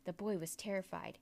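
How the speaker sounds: ordinary delivery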